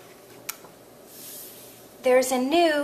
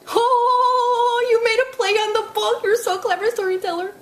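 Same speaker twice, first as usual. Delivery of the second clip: excited praise; 9 semitones higher than usual. Pretend. Puppet praising the storyteller's wordplay, excited sung gushing. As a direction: gush over the storyteller.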